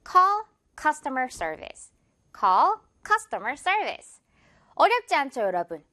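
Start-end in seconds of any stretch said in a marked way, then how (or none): 0.1-4.2 very slow "call customer service", CUS stressed. Shown as a demonstration. Teacher drilling the phrase for learners, slowly stressing CUS in "customer". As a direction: separate "call", then lean on CUS in "customer". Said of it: "cus의 강세 여러분".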